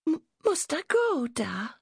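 put-on very high, hesitant child voice; timid little girl. A narrator's reading. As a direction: venture it with a small stammer. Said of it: "she ventured".

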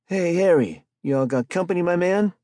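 high, loud street-hustler call. A narrator's reading. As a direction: shout it into the tent, cocky. Spike calls out to Harry.

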